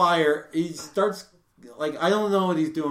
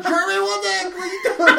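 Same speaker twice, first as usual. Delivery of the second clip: high, exasperated yell; mad; 13 semitones higher, 5 dB louder than usual. Retold or imitated. Camper scolding his tentmate over smoke, mad. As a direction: retell it as an angry, high-pitched outburst. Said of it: "I'm so mad".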